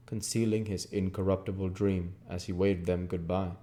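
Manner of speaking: usual speaking voice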